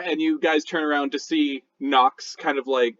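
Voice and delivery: ordinary talk, nothing marked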